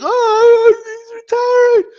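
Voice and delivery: very high mock wailing; hammed-up blubbering, stretched out; Jim Lampley. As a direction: imitate an announcer going all out in tears. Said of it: "he's just going all out".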